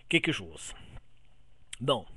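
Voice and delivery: usual speaking voice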